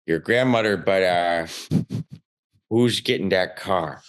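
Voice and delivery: put-on low, halting Chicago-accented condolence; Uncle Mike. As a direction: imitate an uncle angling after token sympathy. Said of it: "my Uncle Mike, first thing out of his mouth".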